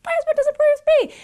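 high, fast whining imitation; mock the complainer in a high whine; hammed-up high whiny wife; whiny mock complaint.

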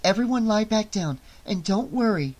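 understated fear under a calm surface; holding back trembling. A narrator's reading. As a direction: reassure the kids while hiding fear. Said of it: "trying to keep her voice from trembling".

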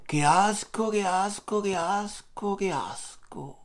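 disgust fading to a soft murmur. Real, not acted. Still reacting to the feet, disgust fading out. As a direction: let the disgust die away.

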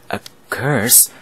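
ordinary talk, nothing marked

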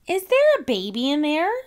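put-on high curious little girl; bright, high child asking; Peppa voice. A narrator's reading. Peppa asks Daddy.

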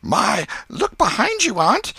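high, loud warning cry; boy's urgent mock-frightened shout; feigned alarm. A narrator's reading. Boy tricking his aunt to escape, alarmed cry.

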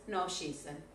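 No, she isn't, said low and soft; teacher showing the negative reply; low quiet answer. Shown as a demonstration. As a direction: drop the voice for the no answer. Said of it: "modelling answers".

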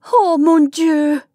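high, slow, loud exclamation in French; mother's anguished urging, drawn out; urgent plea. A narrator's reading. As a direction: cry out high and long, imploring. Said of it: "urged the mother".

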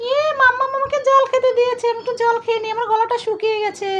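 be a thirsty little toy; little toy character voice. Acted. Toy thanks mummy for water in a small voice.

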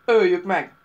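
loud brutish bark. A narrator's reading. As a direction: bark the kill order. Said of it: "vakkantott az egyik szörnykatona".

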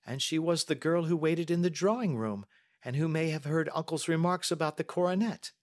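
put-on high-pitched young lady, earnest; Mary. Young lady telling the detective what she heard, earnest. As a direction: answer carefully in a high voice.

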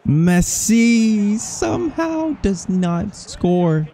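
high, stretched-out incredulous call; slow wail at a missed chance; stunned disbelief. Real, not acted. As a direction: draw the name out high in disbelief.

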